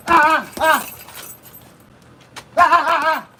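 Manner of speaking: sharp bursts of effort on every strike; punch exertion cries. Real, not acted. Boxer throwing punches in training, effort cries. Punching through a workout round.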